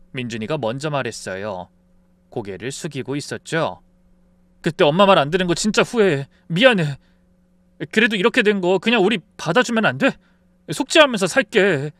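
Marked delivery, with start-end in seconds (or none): 4.6-11.9 rushed, louder plea for forgiveness; pleading son. Acted. Son begging mother to take them in, urgent pleading. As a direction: beg urgently, voice pushed up.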